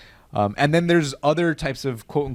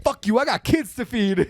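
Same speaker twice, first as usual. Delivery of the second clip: high, slow mock kid voice; kid's retort; 8 semitones higher, slower than usual. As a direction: talk back like a tough little kid. Kid's retort in retold bit.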